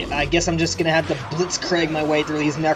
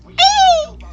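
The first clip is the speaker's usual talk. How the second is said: disgusted squeal; 9 dB louder than usual. Real, not acted. Disgusted by game creature.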